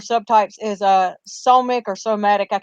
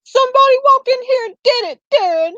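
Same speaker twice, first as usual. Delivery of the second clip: mocking imitation pitched much higher; sarcastic; 13 semitones higher, 4 dB louder than usual. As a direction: toss it back at the husband in a high, mocking voice. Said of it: "like, sarcastically".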